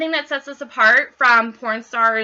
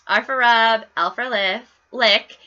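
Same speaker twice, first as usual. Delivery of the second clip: slow, spaced-out mnemonic; walk them through it slowly; slower than usual. Shown as a demonstration. Host demoing the mnemonic slowly for new consultants. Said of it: "I will slow that down for you".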